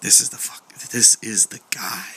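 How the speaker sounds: a tense breathless whisper. Retold or imitated. Facing the long-haired stranger, frightened whisper. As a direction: hiss it to her in fear. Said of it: "And I was like".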